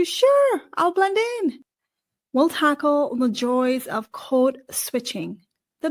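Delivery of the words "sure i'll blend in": high-pitched, breezy mock compliance; mock agreeable. Immigrant giving in to fit in, mock agreeable. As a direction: agree too readily, pitch jumping right up. Acting out going along to fit in.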